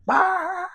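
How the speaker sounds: high, drawn-out "bah" of a bad rattle; imitated car noise. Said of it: "I could hear it really bad".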